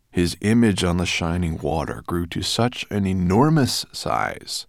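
ordinary delivery

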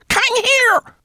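piercing, high final cry; shrill shriek. A narrator's reading. Rat's warning echoing round the cave, shrill shriek. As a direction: shriek it as the last alarm. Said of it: "she squeaked so shrilly".

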